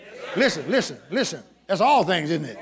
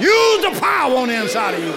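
louder, higher shouted charge; preaching shout; 4 semitones higher, 7 dB louder than usual. Acted. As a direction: fire it at the congregation.

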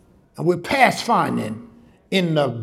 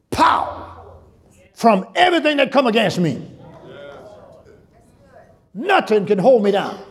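full-force preaching; 5 semitones higher than usual. Real, not acted. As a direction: preach it hard, lifting every phrase.

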